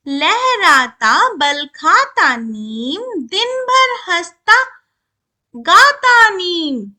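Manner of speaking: slow, drawn-out verse reading; poem recitation. Shown as a demonstration. Teacher reads the neem poem to children, slow recitation. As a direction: stretch each word out in measured verse. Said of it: "चलो अब कविता पढ़ते हैं".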